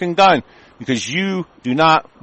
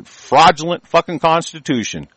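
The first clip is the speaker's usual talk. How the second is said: normal talking voice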